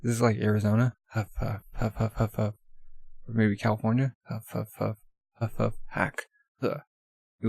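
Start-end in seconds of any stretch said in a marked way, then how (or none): 1.1-2.5 soft run of mock puffs; softly puffed panting noises; imitated huffing. Runner panting in desert. 4.3-6.2 soft mock panting ending in a hack; imitated huffing. Runner panting in desert.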